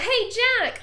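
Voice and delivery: loud, high-pitched holler; shout. Acted. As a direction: call out sharply to interrupt him. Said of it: "he hears another shout".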